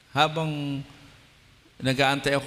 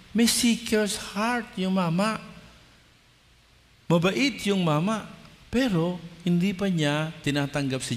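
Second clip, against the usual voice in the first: full-force preaching; 8 semitones higher than usual. Acted. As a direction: drive the promise home to the congregation.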